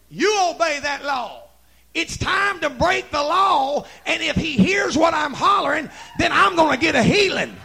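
building to a full preaching shout; defiant leper. Acted. Leper refusing the law that silences him, building defiant shout. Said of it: "And another leper said".

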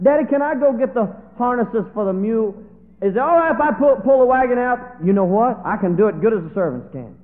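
put-on high, loud excited boy's voice; a boy pleading to pull the wagon; eager boy. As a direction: beg your father like a thrilled child.

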